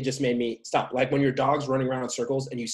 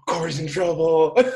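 laughing tease; 5 semitones higher, 6 dB louder than usual. Real, not acted. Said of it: "good point".

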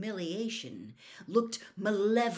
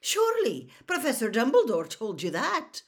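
higher, louder, incredulous scolding; scandalised McGonagall; 5 semitones higher, 6 dB louder than usual. A narrator's reading. McGonagall asks if Dumbledore told Moody, scandalised. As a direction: press the point in disbelief.

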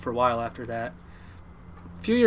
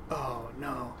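soft, sinking dread; deflated, hushed reaction; quiet dismay; 10 dB quieter than usual. Retold or imitated. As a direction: realize you are in trouble, softly. Dread at leaving after overeating.

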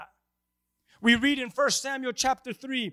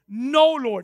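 raised, loud, defiant retort; 3 semitones higher, 8 dB louder than usual. Acted. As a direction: hammer out the refusal. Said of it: "where he says to him".

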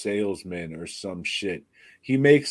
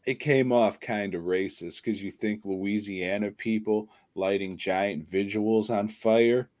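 usual speaking voice

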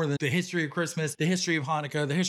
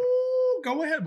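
excited ooh. Acted. Teasing reply to a flirt.

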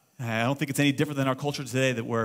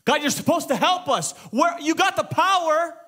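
high, indignant acted shout; raised-voice accusing prayer; yelling at God; 12 semitones higher, 4 dB louder than usual. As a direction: demand answers from God at the top of your range. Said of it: "Habakkuk is yelling at God".

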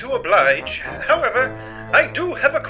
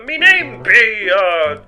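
flustered fake pirate accent with nervous laughs; faked pirate accent; 4 dB louder, slower than usual. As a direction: bluff a pirate backstory, stalling. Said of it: "plan to imitate a pirate".

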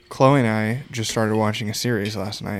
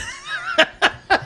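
squealing high laugh. Real, not acted. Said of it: "Gotta laugh".

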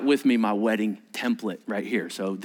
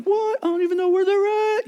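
much higher, louder panicked squeak; a flustered groom caught without the rings; high panicked squawk; startled groom; 4 dB louder than usual. Retold or imitated. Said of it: "the groom's was like".

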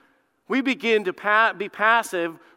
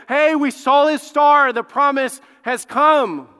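high, slow, loud announcement; acted-out triumphant proclamation; excited proclamation; 6 semitones higher, 6 dB louder, slower than usual. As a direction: herald it with drawn-out emphasis. Acts out wise men announcing to Herod.

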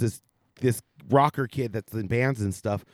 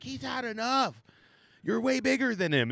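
much higher, softer pleading woman; lightly voiced woman begging him to stop; pleading girl voice; 10 semitones higher, 6 dB quieter than usual. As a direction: bystander girl pleading, voiced high and soft. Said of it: "this chick's like".

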